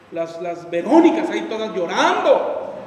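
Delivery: loud, slow mockery of crying victims; mocking victims. Pastor mocking men who play the victim, scornful. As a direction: scoff at the self-pitying.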